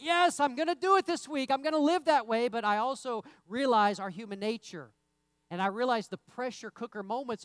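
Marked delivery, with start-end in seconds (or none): 0.0-2.5 higher and louder, eager resolve; mock excited vow. Preacher imitating fired-up worshippers, excited. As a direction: gush like a pumped-up churchgoer. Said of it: "And we're like".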